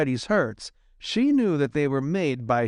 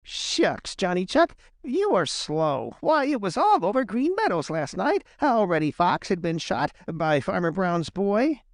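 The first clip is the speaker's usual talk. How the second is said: higher-pitched, mildly mocking rabbit; jeering; 7 semitones higher than usual. A narrator's reading.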